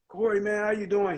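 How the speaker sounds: lightly imitating an upbeat friend calling; energetic greeting. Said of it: "like, really energetic".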